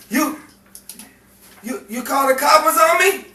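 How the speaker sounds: loud high outraged yell; angry shout. Acted. Gangster accusing a friend of calling the coppers, angry yell.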